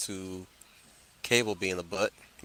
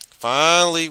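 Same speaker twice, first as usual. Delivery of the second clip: loud, high, excited cry; excited shout; 7 semitones higher, 11 dB louder than usual. Real, not acted. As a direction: belt it out with relief.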